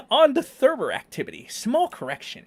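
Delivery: high-pitched nerd imitation, half laughing; hammed-up pedantic correction; mock nerd. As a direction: read it as a high, mocking know-it-all. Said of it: "this nerd over here, saying".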